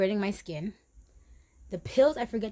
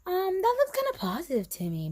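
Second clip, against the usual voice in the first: high-pitched hesitant friend voice; retold friend's opinion, pitched up; imitating a friend; 8 semitones higher than usual. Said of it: "my next friend was like".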